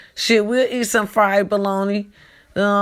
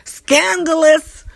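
dramatic exclamation; 10 semitones higher, 7 dB louder, much slower than usual. Pretend. Dramatic gasp at gossip.

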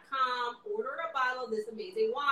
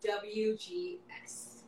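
slow hushed letters, unvoiced; whisper; 7 dB quieter, much slower than usual. Host whispering the acronym to her guest. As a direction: whisper it like a secret.

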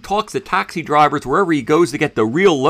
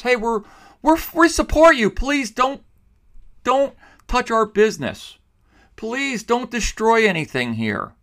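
imitating begging; 7 semitones higher than usual. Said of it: "They were basically begging".

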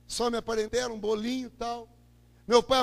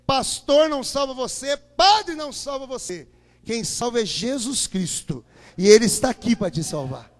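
louder, slowing preacher's call; preaching at full force; 7 dB louder than usual. Acted. Preacher declares to the church that only Jesus saves, in a rising preaching voice. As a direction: drive it home to the congregation.

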